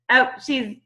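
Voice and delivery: usual speaking voice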